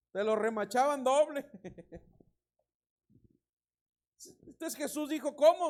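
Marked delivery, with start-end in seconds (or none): 5.3-5.7 indignant ¿cómo?. Acted. Jesus voiced answering the Pharisees.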